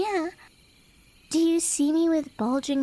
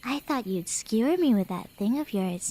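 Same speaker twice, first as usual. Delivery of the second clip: normal talking voice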